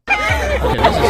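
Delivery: a startled high scream; sudden yell. Real, not acted. Reacting to the clip with a loud high shriek.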